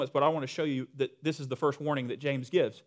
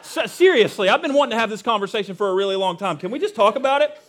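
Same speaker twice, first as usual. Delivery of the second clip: loud, hammed-up young-crowd complaint; mock-plead in exasperation about the elders; exasperated younger person; 4 semitones higher, 7 dB louder than usual. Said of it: "then there's the younger crowd".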